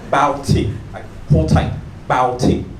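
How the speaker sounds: ordinary talk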